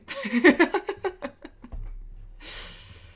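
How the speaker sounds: long, high, loud laugh; burst of laughter. Real, not acted. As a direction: crack up and let it tail away. Bursts out laughing after the apology.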